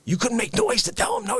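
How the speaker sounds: ordinary talk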